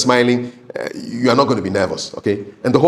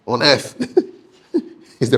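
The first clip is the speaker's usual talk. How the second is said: laughing. Shown as a demonstration. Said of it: "an example would be".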